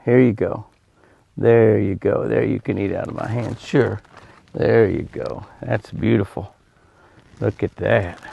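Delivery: tender, quiet encouragement; gentle soothing. Real, not acted. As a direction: talk softly to a deer feeding from your hand. Soothing deer eating from his hand.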